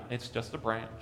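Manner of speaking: put-on soft, quiet comfort to a scared child; gently calm a frightened child; reassuring parent. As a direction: parent soothing a child afraid of the dark, softly. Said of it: "but rather reassures them".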